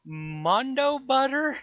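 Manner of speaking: loud, high, drawn-out disbelief; shocked shout. Acted. Shocked outburst in game scene.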